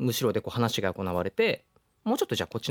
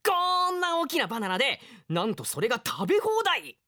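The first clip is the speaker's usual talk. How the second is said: much higher, showy brag; boastful excitement; 14 semitones higher than usual. Acted. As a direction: sell it big. Boy brags about bananas.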